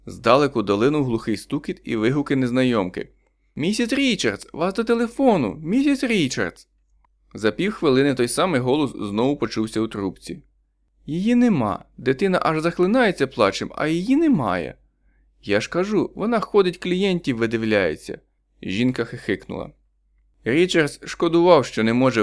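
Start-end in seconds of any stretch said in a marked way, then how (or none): 3.6-6.7 high call down the hall. A narrator's reading. Woman calling down hallway. 11.1-18.2 higher, spiteful gossip; sly neighbour. A narrator's reading.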